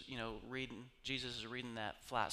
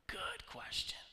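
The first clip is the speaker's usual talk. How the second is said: whisper; 5 semitones higher, 5 dB quieter, slower than usual. Real, not acted. Quiet aside after sigh.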